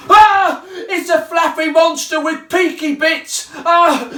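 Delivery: high, shrill panicky cry with a laugh in it; Big Mug panics at the monster; frightened shriek. Acted. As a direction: scream at the scary monster. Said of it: "said Big Mug".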